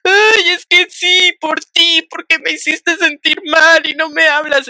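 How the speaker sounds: put-on high, slow drunken whine of the friend; drunk friend whining. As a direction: drag it out like a weepy drunk. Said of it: "Y me dice".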